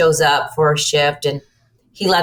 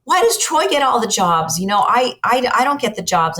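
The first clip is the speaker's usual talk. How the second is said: lightly voiced son, higher and whining a little; son complaining; 7 semitones higher than usual. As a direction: whine like a hurt young man asking mom. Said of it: "Brent goes".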